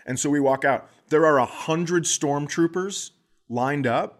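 ordinary talk

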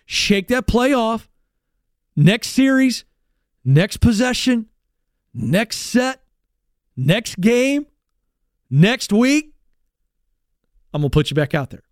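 rally a player, each phrase set apart; empowering coach. Acted. Coach to player after a bad play, rallying and empowering. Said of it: "The coach says".

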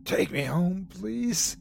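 exhausted croaked request; croaked plea. A narrator's reading. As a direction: croak it out, spent from crying. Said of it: "I managed to croak".

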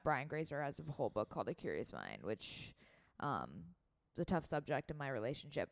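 low creaky vocal fry. Real, not acted. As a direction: let the voice sink into fry. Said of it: "do I sense some vocal fry".

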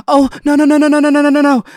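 high, loud string of no's; a run of alarmed no's as danger unfolds; retold panic, shouted high; panicked no. Sees girl heading into traffic.

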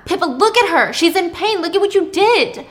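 high, loud accusing voice; mad. Acted. Thomas blaming Pippa for the neighbor's pain, mad. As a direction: rebuke them for the harm they caused. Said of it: "And Thomas is mad".

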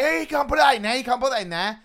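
put-on high, loud kid scolding; sing-song child protest; mocking kids telling off. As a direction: tell her off like a cheeky schoolkid. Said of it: "They're like".

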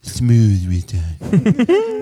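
very slow, smooth, jaw held back; jaw pulled back voice. Shown as a demonstration. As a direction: drawl it out, jaw drawn back. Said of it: "Where I pull the jaw back".

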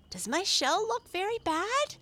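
high little turtle, worried; worried little turtle cry. A narrator's reading. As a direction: fret about his shell. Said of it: "he cried".

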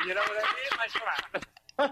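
ordinary talk, nothing marked

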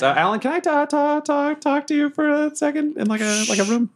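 high, stammering plea; awkward, halting character voice; flustered Bobbert. Acted. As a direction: trip over the request in a high voice. Flustered Bobbert seeks private talk.

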